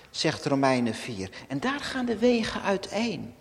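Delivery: normal talking voice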